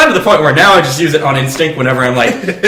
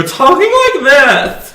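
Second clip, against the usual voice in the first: much higher, slow breezy voice; nonchalant work voice; 10 semitones higher, slower than usual. Shown as a demonstration. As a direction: toss it off in a high casual lilt. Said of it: "I have a penchant at work for".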